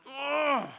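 high, re-enacted cry of fright; terrified groan. Speaker re-enacts being terrified in the pipe, frightened cry. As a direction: let out a startled "ugh" high up. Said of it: "I was terrified in that flippin' pipe".

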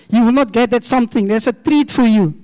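normal talking voice

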